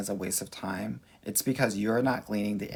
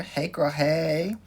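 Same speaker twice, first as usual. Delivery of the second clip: sing-song greeting; 6 semitones higher, much slower than usual. Real, not acted.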